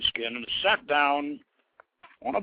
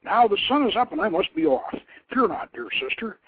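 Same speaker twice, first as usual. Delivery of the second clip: higher, louder, brisk cheerful prince; breezy and eager to be off; a prince laughing off his sister's fears; smiling brother; 9 semitones higher, 7 dB louder than usual. Acted. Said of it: "Akkad only smiled".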